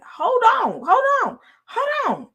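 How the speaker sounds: high, loud hold on hold on; shrill-free high indignant repeat; high exclaiming protest. Retold or imitated. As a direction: throw up your hands and stop the doctor. Stopping an abrasive doctor.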